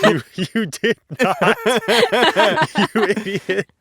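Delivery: mock him while cracking up; laughing scorn. Bartimaeus mocking Nathaniel, laughing scorn. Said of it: "And Bartimaeus is like".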